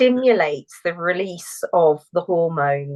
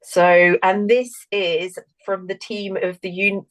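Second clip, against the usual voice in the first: normal talking voice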